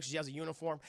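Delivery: normal talking voice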